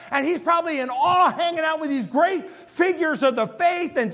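loud, high, impassioned sermon; fiery preaching. Real, not acted. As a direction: preach it full out to the room. Preaching at full force.

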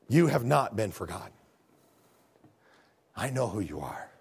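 soft, low, comforting voice; quiet comfort to the persecuted; God reassuring his people softly; gentle reassurance. Retold or imitated. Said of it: "to encourage them, not to scare them".

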